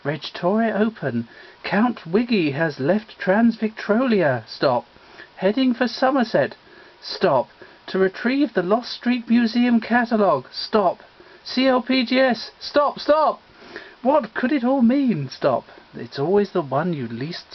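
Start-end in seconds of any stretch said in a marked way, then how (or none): 1.6-13.4 telegram read. Acted. Reading a telegram aloud.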